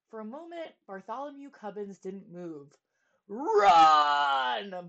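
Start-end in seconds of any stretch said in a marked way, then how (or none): 3.3-4.9 loud harsh royal order; king barking an order at the page boy; barked. Acted. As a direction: bark the command. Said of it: "barked the king".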